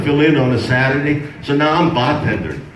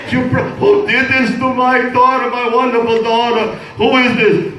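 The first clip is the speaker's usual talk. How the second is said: high and indignant throughout; a hammed-up father's fury, pitched way up; mock outrage; 9 semitones higher than usual. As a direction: play the furious father. Father outraged at daughter's pregnancy.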